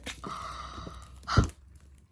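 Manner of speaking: sharp breathless gasp of delight; excited gasp. Real, not acted. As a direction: gasp in awe. Gasping at the merch.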